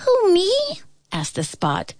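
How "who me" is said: high squeaky tiny voice; flea voice. A narrator's reading.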